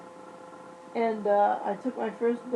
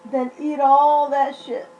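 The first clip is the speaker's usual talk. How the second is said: louder, slow mocking dare; sarcastic dare; 3 semitones higher, 9 dB louder, slower than usual. Real, not acted. Sarcastic warning to viewers.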